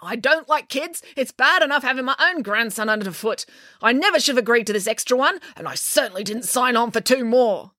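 put-on high, grumpy old lady rant; irate cat lady. A narrator's reading. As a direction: complain crossly, gathering speed.

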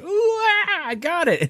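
high squeaky little creature, pleased; chirpy high critter voice. Retold or imitated. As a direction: squeak it out like a tiny mummy catching a prize. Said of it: "one of these little bastards ... is like".

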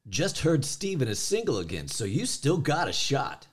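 punchy, lifted commercial delivery; commercial read. Acted. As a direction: sell it like a radio spot. Reads commercial audition line.

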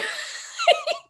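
shrill giggle of a busybody; mock high laugh. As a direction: cap the mimicry with a squeal of a laugh. Laugh after mimicked question.

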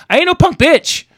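high, loud tough-guy protest; mock defiance. Acting out his own defiant stand.

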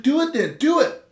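high, mocking dare voice; taunting dare. Friend taunting him to stab him, high mocking dare. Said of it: "He's like".

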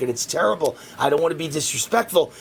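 ordinary talk

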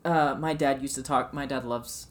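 normal talking voice

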